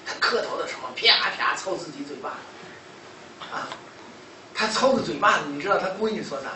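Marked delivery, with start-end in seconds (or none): none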